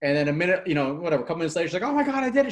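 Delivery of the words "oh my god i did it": high-pitched, retold burst of amazement; shaken disbelief. Client to coach just after recording, shaken disbelief. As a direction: imitate someone overwhelmed by what they just did. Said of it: "She's, like, shaking".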